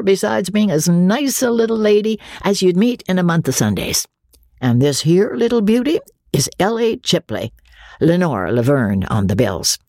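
high, hearty showman's patter; bluff riverboat captain. A narrator's reading. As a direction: introduce them with nervous bravado. Andy voiced, held figure.

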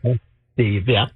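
distant speakerphone sound; talking from across the room on speaker. Real, not acted. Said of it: "'Cause you sound like you're on a speakerphone".

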